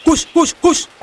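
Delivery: high quick shoo, in short bursts; shooing a cat. Acted. Someone chasing off a passing cat, high shooing call. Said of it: "aya nu ngagebah".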